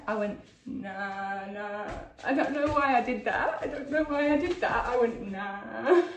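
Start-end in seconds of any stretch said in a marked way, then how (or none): 0.6-1.9 low, softer, drawn-out mock reply; out-of-character "nah". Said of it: "I don't talk like this". 5.3-6.0 drawn-out "nah" breaking into laughter; out-of-character "nah". Retold or imitated. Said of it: "I don't talk like that".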